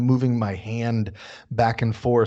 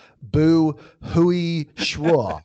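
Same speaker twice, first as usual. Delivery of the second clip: "不会说" stretched out slowly and hesitantly; 4 semitones higher, 3 dB louder, much slower than usual. Shown as a demonstration. Learner slowly, hesitantly telling a local "不会说". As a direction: drag out "不会说" as if unsure of yourself. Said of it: "you should say, like".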